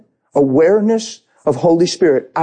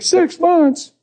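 very high shocked squeak; mock shock. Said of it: "They're like".

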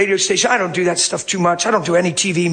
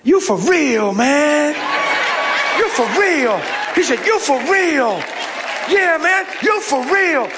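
higher, a hint of Southern awe; Southern amazement; 7 semitones higher than usual. Maintenance man marveling at the preacher, Southern awe. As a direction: marvel at him in a lightly voiced Southern drawl. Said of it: "he'd be good in church".

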